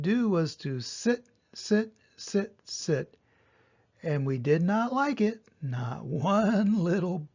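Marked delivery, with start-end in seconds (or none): none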